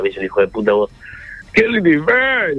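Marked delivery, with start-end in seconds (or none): 1.5-2.6 high, stretched-out catchphrase cry; mock shout. Shouting the name his friend fed him, mock shout. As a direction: call it out slow and high like a gag. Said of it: "No, vos gritá".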